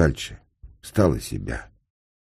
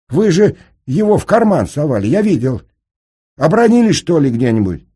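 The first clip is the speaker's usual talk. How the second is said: high, loud, agitated questioning; alarmed protest; 13 semitones higher, 7 dB louder than usual. A narrator's reading. Senka asks whether he dropped the pistol, high and alarmed. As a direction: blurt it out in disbelief.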